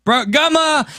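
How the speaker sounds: high, excited shout-out; excited greeting. Real, not acted. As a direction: greet the viewer with delight. Greeting chat viewer excitedly.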